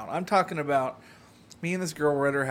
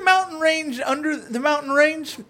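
a hammed-up Canadian from up north; mock Canadian accent; 10 semitones higher than usual. Telling Justin where he lives, in a mock Canadian accent.

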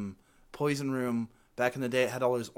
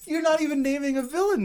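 high, loud exasperated objection; infuriated; 5 dB louder than usual. Real, not acted. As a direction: press the point in disbelief. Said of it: "This is so infuriating".

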